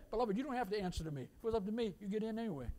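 a light imitation of a permissive pastor; lenient pastor. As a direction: toss it off casually and kindly. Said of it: "I know a pastor said".

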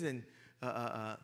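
usual speaking voice

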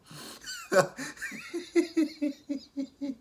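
laughing high and helpless; high squealing laugh. Real, not acted. Vlogger losing it over Angela, high squeal of laughter.

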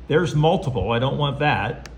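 normal talking voice

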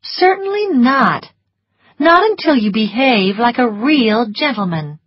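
high, haughty rebuff; cool, lofty voice starting high; cool refusal. A narrator's reading. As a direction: turn him down coolly and primly. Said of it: "answered in a cool voice".

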